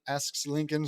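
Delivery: usual speaking voice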